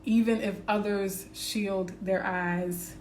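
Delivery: slow, earnest affirmation read. Acted. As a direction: savour each phrase like a mantra. Reads affirmations over music.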